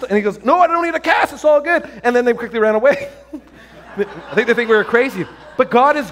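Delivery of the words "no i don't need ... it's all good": high-pitched excited retelling; excited refusal. Retold or imitated. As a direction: wave them off in a high, urgent voice. Retold patient excitedly refusing help.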